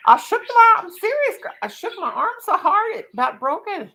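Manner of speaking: high, loud, giggly exclamation; excited. Real, not acted. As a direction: squeal it out in disbelief. Laughing high excited telling.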